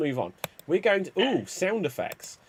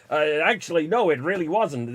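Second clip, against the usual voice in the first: ordinary talk, nothing marked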